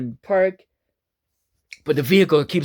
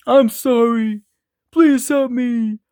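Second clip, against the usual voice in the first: high, slow, whiny begging; pleading machine; 6 semitones higher, much slower than usual. Acted. Motorhome begging couple not to leave, slow whine. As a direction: drag out a pitiful plea.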